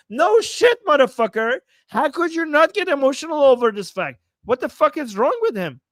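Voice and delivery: high, loud exasperated rant; angry outburst. Real, not acted. Streamer rails at the commentator's remark, angrily exasperated. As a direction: lash out, pitch jumping on the first words.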